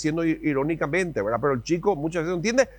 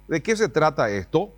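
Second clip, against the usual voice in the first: normal talking voice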